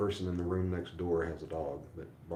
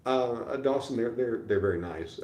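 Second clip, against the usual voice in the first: eager reply; 5 semitones higher, 8 dB louder than usual. Real, not acted. Excitedly answers a viewer.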